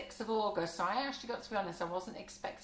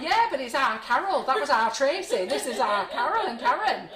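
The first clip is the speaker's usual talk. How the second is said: loud, high, laughing exclamation; excited laughing shout; 5 semitones higher, 10 dB louder than usual. Retold or imitated. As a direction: belt it out through laughter. Acted reply to partners, laughing.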